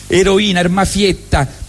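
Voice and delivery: usual speaking voice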